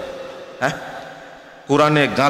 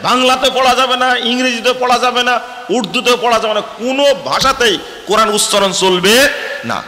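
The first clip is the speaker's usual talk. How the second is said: high, loud, fiery sermon peak; preaching at full force; 9 semitones higher, 4 dB louder than usual. Acted. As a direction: thunder it out to the congregation. (উচ্চস্বরে জোরালো ওয়াজ)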